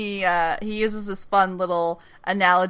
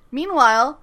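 ordinary delivery

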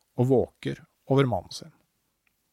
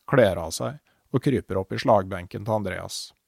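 ordinary delivery, nothing marked

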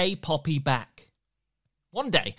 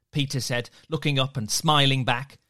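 ordinary delivery, nothing marked

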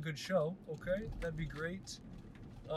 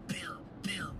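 whisper; 4 dB quieter than usual. Acted. Taunting Shakespeare.

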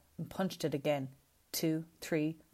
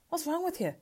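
much higher shocked question; 13 semitones higher than usual. A narrator's reading. Javier to Luca, high shocked question. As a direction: recoil at his brother's outburst.

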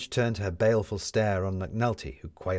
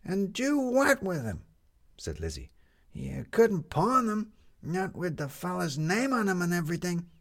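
put-on small girl's high, earnest protest; young girl Lizzie; 12 semitones higher than usual. A narrator's reading. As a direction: play the little girl protesting. Lizzie's child voice.

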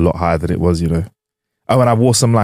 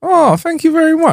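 mock flattered. Mock flattered reaction, very high.

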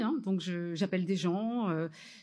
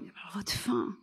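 whispered retold criticism; mock hushed letdown; 4 dB quieter than usual. Producer breaking bad news about the ending, whispered. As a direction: drop to a whisper, imitating the producer.

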